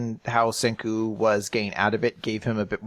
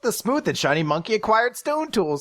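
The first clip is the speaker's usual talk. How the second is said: high, mock-triumphant item pickup voice; playful high game-prompt voice; video game announcement; 10 semitones higher than usual. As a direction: announce it like a game achievement, pitched up. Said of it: "video game thing".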